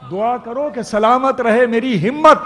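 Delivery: loud stage declamation of a couplet; ghazal recitation. Acted. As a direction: ring out the verse to the hall. Poet recites verse at mushaira.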